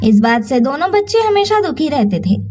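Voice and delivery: ordinary delivery, nothing marked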